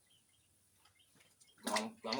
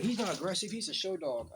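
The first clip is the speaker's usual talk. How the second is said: higher, louder mock neighbor; neighbor's excuse, pitched up and louder; quoting the neighbor; 9 semitones higher, 4 dB louder than usual. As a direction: defend the dog as the neighbor. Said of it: "I said".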